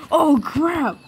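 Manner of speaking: high exclamation that cracks. Real, not acted. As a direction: let your voice crack in alarm. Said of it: "What was that voice crack right there?".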